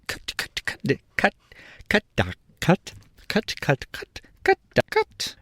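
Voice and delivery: high clucking cut cut cut; high clucking hen cry; hen cackle. A narrator's reading. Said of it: "a shrill voice suddenly cried".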